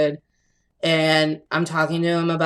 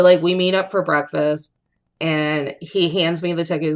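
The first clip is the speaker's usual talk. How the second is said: ordinary talk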